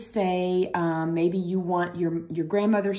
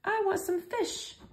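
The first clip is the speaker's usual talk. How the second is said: high pretend student; 4 dB quieter than usual. Teacher voicing a student asking for fish, pitched high. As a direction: pretend request, pitched much higher. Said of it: "how to say some".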